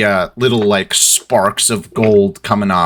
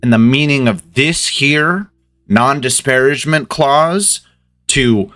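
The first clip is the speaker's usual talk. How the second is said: indignant boss, pitch climbing; exasperated reprimand, tightly held; through gritted teeth; 6 semitones higher than usual. Acted. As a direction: scold with pointed, rising emphasis. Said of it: "talking through gritted teeth here".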